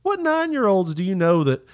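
high, slow, drawn-out disbelief; incredulous. Real, not acted. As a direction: stretch it out in outrage. Incredulous protest at punishment.

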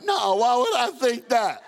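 high, loud, drawn-out protest; defensive denial. Acted. Boy denying to dad he feared rapture, defensive denial. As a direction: protest too much, hammed-up.

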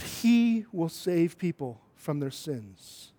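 "he" drawn out, the rest slow and even; stress on HE. Shown as a demonstration. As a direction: stretch "he", then pause before the end. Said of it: "put some different emphasis".

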